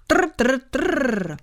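high rattling tr tr tr; typewriter rattle. Acted. Narrator voices the typewriter keys, rattling. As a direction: imitate typewriter keys clattering. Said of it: "zarachotily klapky".

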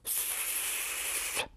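long, soft voiceless hiss; snake hiss. A narrator's reading. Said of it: "co Jozífek vždycky slyší". (tiché zasyčení hada)

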